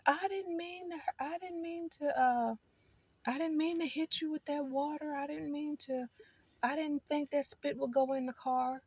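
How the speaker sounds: high, soft, whiny fake apology; mock innocent voice. Mocking people who spit into her car, high and whiny. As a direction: mimic a rude person's sing-song excuse. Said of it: "And they say".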